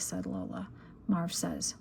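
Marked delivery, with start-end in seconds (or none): none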